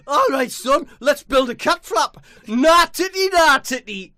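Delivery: high, loud silly voice; high loud comic character voice; high put-on voice. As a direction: bark it out in a daft high voice.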